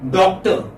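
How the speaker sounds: "Doctor" ending in a schwa, no R; silent final R. Shown as a demonstration. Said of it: "It's not pronounced".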